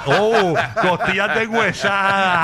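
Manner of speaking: slow drawn-out craving with laughter; savoring, laughing. Pretend. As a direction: stretch it out like tasting it. Savoring food names, laughing.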